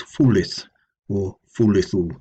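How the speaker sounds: fulith and fulithu sounded out; pre-Old English forms. Shown as a demonstration. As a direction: say fulith, fulithu with the i clearly voiced. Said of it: "in the pre-Old English times".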